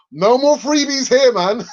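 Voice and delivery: high, loud, slow laughing tease. Real, not acted. Joking to the chat about paid accents, laughing. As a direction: laugh through the joke.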